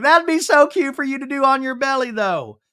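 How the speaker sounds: high-pitched retold gush; mock gushing. Brittany to pregnant friend about yoga, mock gushing. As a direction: pitch it way up as an acted cooing friend.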